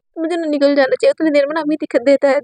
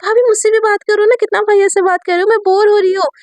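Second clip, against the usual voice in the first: higher, louder whiny little sister; whiny girl; 7 semitones higher, 3 dB louder than usual. Acted. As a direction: whine for attention like a bored kid sister. Teen girl whining for attention. (भाभी से ऊँची आवाज़ में ठुनकती छोटी ननद)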